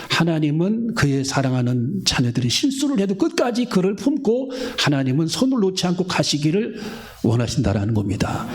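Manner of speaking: usual speaking voice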